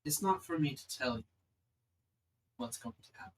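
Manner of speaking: lightly voiced celestial being, measured with long pauses; an understated goddess pondering mysteries, slowing on key phrases.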